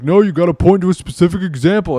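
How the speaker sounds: high, loud mocking whine of the opponent; mocking imitation. Mocking opponent's demand in a quote.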